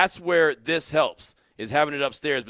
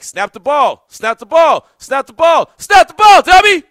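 exasperated shout, building louder and higher; yelling at the TV; 12 semitones higher, 16 dB louder than usual. Retold or imitated. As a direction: re-enact a frustrated fan's escalating yell. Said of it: "I start yelling at the T V".

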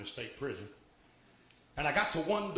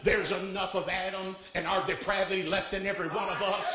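preaching at full force; 7 semitones higher, 5 dB louder than usual. Acted. Preacher to congregation, roaring warning about the flesh. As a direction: build it up to a shout from the pulpit.

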